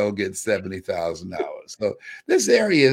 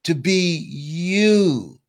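rising sermon; 4 semitones higher than usual. Acted. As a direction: lift them up like a preacher. Preaches to the audience.

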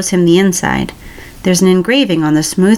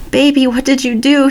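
tearful plea, higher; crying out to him in tears; 7 semitones higher than usual. Acted. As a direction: voice lifting through tears. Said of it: "he cries".

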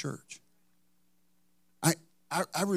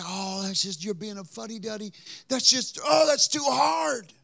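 mock complaining; 7 semitones higher than usual. Voices objecting listeners' complaint.

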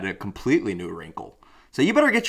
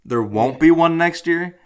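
surprised question; 7 semitones higher, 4 dB louder, slower than usual. Real, not acted. Disbelieving question.

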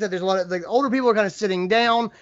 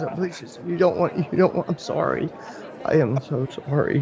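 quiet, near-crying apology; tearful pleading; 3 semitones lower, 4 dB quieter than usual. Acted. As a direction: plead softly as if holding back tears. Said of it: "the woman starts to cry".

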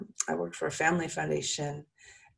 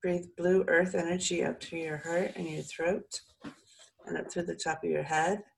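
calm soothing voice; 2 semitones higher than usual. Acted. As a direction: ease them into calm. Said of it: "let my voice calm you and soothe you".

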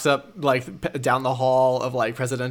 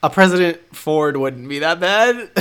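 lightly put-on voice, higher and louder; mock wistful musing; 5 semitones higher, 4 dB louder than usual. Friend joking about a President Ford, higher put-on voice. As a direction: muse as if in on the joke. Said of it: "I'm literally like".

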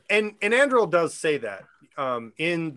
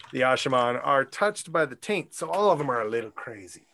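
normal talking voice

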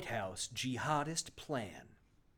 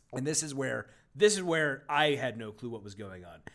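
ordinary delivery

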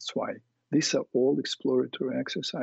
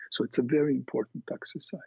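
ordinary talk, nothing marked

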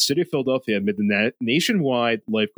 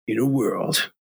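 booming trailer narrator; movie trailer voice; 2 semitones higher than usual. Retold or imitated. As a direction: epic movie trailer read. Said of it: "I can't get that low without going right into vocal fry".

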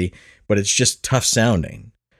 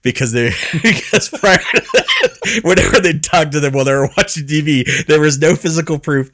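higher, laughter breaking up the words; laughing explanation; 4 semitones higher, 4 dB louder than usual. Real, not acted. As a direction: try to explain while cracking up. Cracks up during fake fact.

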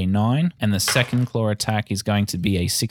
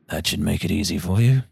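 ominous hooded stranger; 4 semitones lower than usual. Acted. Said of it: "he says".

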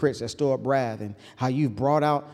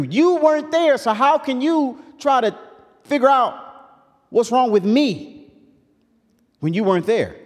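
high, loud preached rebuke of the friends; Job's reproach; 8 semitones higher, 4 dB louder than usual. Acted. Job telling his friends they weren't there, raised and pleading. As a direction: cry it out as Job pleading he was misjudged.

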